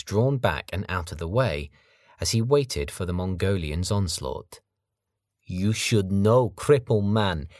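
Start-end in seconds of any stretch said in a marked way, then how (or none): 5.4-7.5 menacing foreign thug. Acted. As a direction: sneer it like a big brute. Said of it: "explained Sergei".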